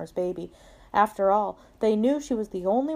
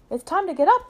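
high, louder dog voice; pitched-up read-aloud dog; storybook dog; 9 semitones higher, 6 dB louder, faster than usual. A narrator's reading. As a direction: announce it as the eager dog. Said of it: "says Dog".

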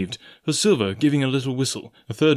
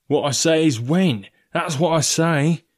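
raised, insistent sailor voice; Israel Hands; 5 semitones higher, 4 dB louder, slower than usual. A narrator's reading. Coxswain demanding to know when, insistent. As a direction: press the point stubbornly.

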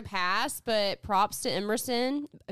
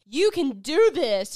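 loud, higher, drawn-out pep talk; hype man; 5 semitones higher, 8 dB louder than usual. Retold or imitated. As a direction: cheer them on like a hype man. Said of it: "her hype man".

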